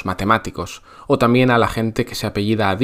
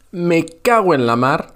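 a higher, louder spontaneous curse; spontaneous outburst; 6 semitones higher, 4 dB louder than usual. Shown as a demonstration. Teacher showing how natives blurt a spontaneous curse. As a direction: blurt it out on the spot. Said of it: "así de forma muy espontánea".

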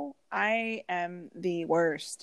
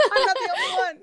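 high, fast laughing line; a real burst of laughter, higher and faster; 4 dB louder, faster than usual. Real, not acted. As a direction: giggle it out. Laughing at own joke.

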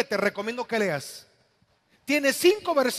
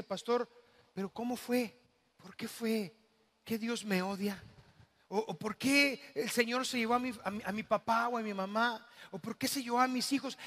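soft, subdued voice of the bereaved; hushed imitation of a hurt churchgoer; grieving questioning; 6 dB quieter than usual. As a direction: ask the pastor quietly, searching for a reason. Voices grieving people asking the pastor.

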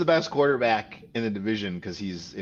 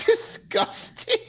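high drawn-out disgusted cry; much slower than usual. Pretend. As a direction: stretch it out in mock disgust. Hamming up a joke insult.

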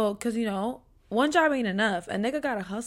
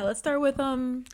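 ordinary talk, nothing marked

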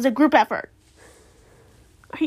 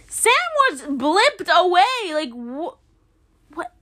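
high, loud, drawn-out protest; exasperated fan protest; indignant disbelief; 5 semitones higher, 3 dB louder, slower than usual. Real, not acted. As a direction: spell it out in disbelief, slowly. Objecting to the banker's logic.

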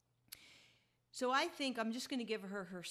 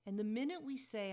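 ordinary talk, nothing marked

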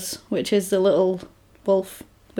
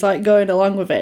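ordinary talk

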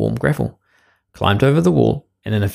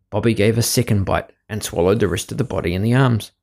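usual speaking voice

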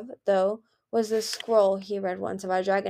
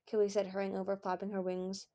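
usual speaking voice

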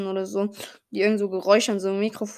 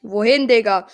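loud exclamation; 11 dB louder than usual. Real, not acted. Reacting to game moment.

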